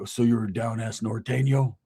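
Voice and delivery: low, slow impression of the huge boss; big man's voice. Huge boss questioning the newcomer, low and slow. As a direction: size him up low and slow. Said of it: "that's how he talked".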